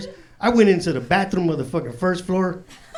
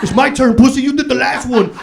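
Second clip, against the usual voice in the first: put-on higher, louder kid-like bickering; mock squabbling; 6 semitones higher, 7 dB louder than usual. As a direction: act out brothers fighting over a turn. Kids squabbling at the stove.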